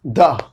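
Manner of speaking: द said short, tongue touching the teeth. Shown as a demonstration. Teacher demonstrating the short sound to students. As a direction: clip द to a short "thuh". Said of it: "द, ये कहां से निकलता है".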